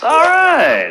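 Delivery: high, drawn-out cheery okay; pleased acceptance. Acted. Man satisfied with Alexa's answer, high and cheery. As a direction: take the answer happily, pitch jumping up.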